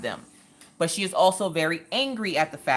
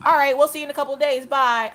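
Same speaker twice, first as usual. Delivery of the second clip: hurried goodbye; 10 semitones higher, 5 dB louder, faster than usual. Retold or imitated. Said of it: "I'm like".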